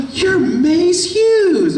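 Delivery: very high, slow, starstruck squeal; excited fan squeal. Retold or imitated. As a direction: gush it out way up high. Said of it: "a bikini says".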